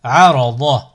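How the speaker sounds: syllables joined as one word. Shown as a demonstration. Teacher models a word for learners to repeat, joined in one go. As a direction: run the syllables together without pauses. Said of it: "ensuite le mot".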